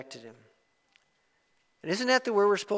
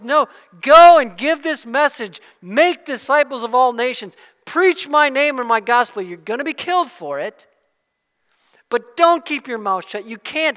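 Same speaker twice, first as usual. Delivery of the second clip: louder, higher rallying charge; bold command; 6 semitones higher, 12 dB louder than usual. Retold or imitated. Jesus commanding his disciples to preach, loud and stirring. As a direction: send them out with full force.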